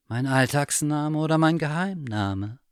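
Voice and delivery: a hint of a teasing cat; lightly voiced mysterious cat. Cat answers the bear slyly, lightly voiced. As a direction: answer with a sly question. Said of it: "fragte die Wanderkatze zurück".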